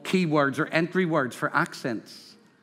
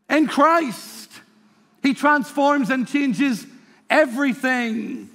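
higher, driving sermon delivery; preaching; 6 semitones higher than usual. Acted. Preacher reciting verses to the congregation, emphatic preaching. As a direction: preach it with mounting force.

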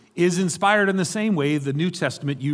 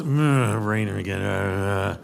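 mock mumbling about the rain, low; grumbling mumble; 8 semitones lower, slower than usual. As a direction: mutter it to yourself glumly. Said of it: "See, I was".

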